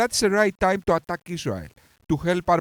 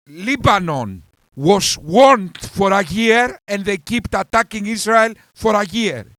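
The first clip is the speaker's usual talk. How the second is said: louder and higher, real fury breaking through; angry shouting; 4 semitones higher, 6 dB louder than usual. Real, not acted. Yelling at the leftist debater about Lebanon, furious. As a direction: shout it at him, furious and fed up. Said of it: "I'm really angry right now".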